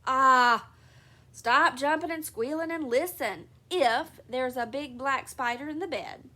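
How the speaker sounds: father tells his daughter to stop and listen, loudly. Acted. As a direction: raise your voice to settle the child. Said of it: "he added in a loud voice".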